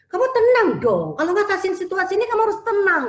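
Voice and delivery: high, loud nagging "calm down"; impatient parent. Retold or imitated. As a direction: scold the child to be calm while clearly not calm. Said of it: "kalau kita lihat dari nadanya, orang tuanya sendiri juga nggak sabar".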